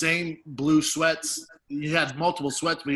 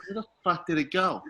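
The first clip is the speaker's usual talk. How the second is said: ordinary talk, nothing marked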